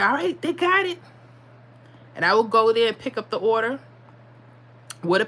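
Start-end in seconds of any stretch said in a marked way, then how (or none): 0.0-1.0 higher grandma reassuring; grandma's voice. Retold or imitated. Grandma confirms the order went through, in a high voice. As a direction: lift into grandma's sure tone. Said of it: "she's like".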